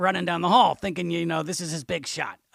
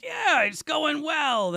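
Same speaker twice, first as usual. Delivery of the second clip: forced cheerfulness; 9 semitones higher, much slower than usual. Retold or imitated. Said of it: "And I'm like".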